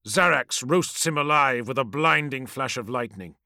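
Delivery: plain reading voice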